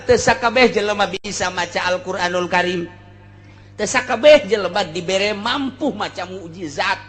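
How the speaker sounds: usual speaking voice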